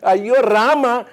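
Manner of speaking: high, loud, slow ayyo wail. Acted. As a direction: wail it out in mock despair. Mock despair at late report.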